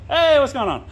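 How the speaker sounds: high, bright-pitched hail to passers-by; sudden high greeting thrown to someone off camera; calling out greeting. Real, not acted. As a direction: call out a friendly hello. Greeting people passing by out there.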